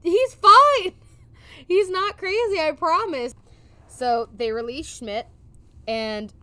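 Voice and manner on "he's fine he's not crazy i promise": high, loud pleading boss. Retold or imitated. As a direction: squeak it out like a nervous boss. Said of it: "he's like".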